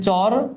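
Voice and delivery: "চর" alone, drawn out with a rising tune; "চর" held and lifted at the end; split into syllables. Shown as a demonstration. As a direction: sound out "চর" as one separate syllable. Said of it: "ছোট ছোট অংশে ভাগ করি".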